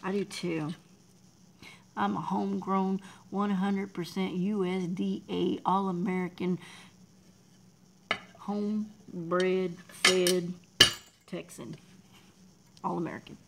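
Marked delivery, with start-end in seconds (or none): 2.0-13.4 loud, proud boast; a proud all-American shout; proud declaration. Real, not acted. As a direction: belt it out like a proud Texan.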